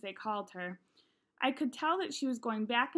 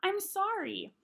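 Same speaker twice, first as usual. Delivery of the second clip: crying; 9 semitones higher than usual. A narrator's reading. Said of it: "I started to cry".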